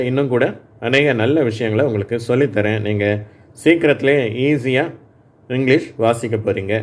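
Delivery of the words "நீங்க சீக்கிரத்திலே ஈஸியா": ordinary talk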